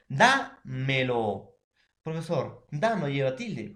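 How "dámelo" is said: "dámelo" said very slowly, stress on DÁ; teacher stretching out "dámelo"; slow demonstration. Shown as a demonstration. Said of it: "Entonces, profesor".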